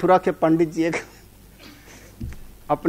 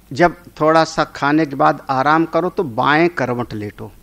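ordinary delivery

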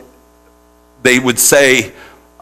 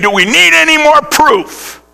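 indignant priest; 11 semitones higher than usual. Retold or imitated. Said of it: "he ripped his garments and said".

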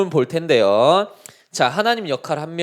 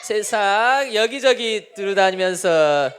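snide sarcasm; 3 semitones higher than usual. Acted. Satan answering God back, in a snide, sarcastic tone. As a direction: act cheeky and obnoxious, stretching the words. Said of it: "비아냥거리는 말투로, 아주 얄미운 말투로".

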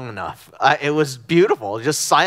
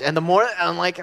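ordinary talk

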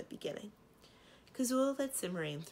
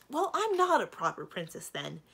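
higher, louder indignant retort; snapping; 5 semitones higher, 7 dB louder than usual. A narrator's reading. Princess snapping at the dragon who objected, indignant. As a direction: snap back at the objector. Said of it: "Simrine snapped".

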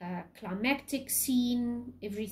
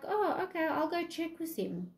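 much higher, falsely innocent "Oh, okay"; sly Iago; 8 semitones higher than usual. Retold or imitated. Iago to Desdemona, pretending to help, high mock-innocent voice. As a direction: play Iago's fake helpfulness, pitched well up. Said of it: "And Iago's like".

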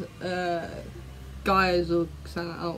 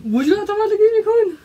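very high, louder silly voice; playful squeaky voice; 12 semitones higher, 6 dB louder than usual. Acted. Co-host echoing the silly translated question, playful squeaky voice.

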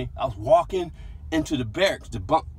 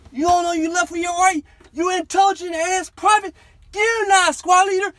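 high, loud furious tirade; drill sergeant yelling; 9 dB louder than usual. Retold or imitated. Drill sergeants berating recruit who went left, furious yelling. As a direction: scream down at the private who turned wrong. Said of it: "they just yelling at me".

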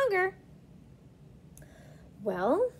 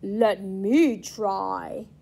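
low ghost voice; 7 semitones lower, much slower than usual. Acted.

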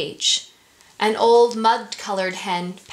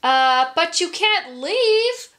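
ringmaster voice; 8 semitones higher, 3 dB louder, slower than usual. Acted. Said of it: "the ringmaster said".

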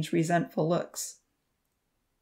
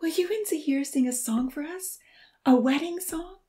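put-on higher, eager pleading girl; 10 semitones higher than usual. A narrator's reading. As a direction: beg sweetly for a wedding song. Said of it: "Lily pleaded".

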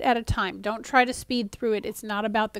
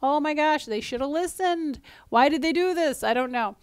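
pitched-up mock exasperation; a lightly voiced frustrated reader, higher; 5 semitones higher than usual. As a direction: play the fed-up reader, lightly. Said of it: "where your frustrations are when you're reading the story and go".

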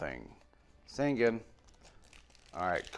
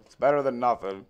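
normal talking voice

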